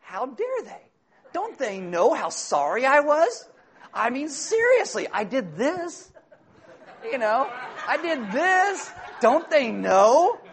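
slow, laughing, high whine of wounded pride; indignant whining. Retold or imitated. Driver offended that his apology went unnoticed, slow laughing whine. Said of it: "And you're like".

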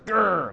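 loud mock growl; short hammed-up "grr"; grumpy growl. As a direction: growl like a host dreading guests. Mimics unwelcoming host.

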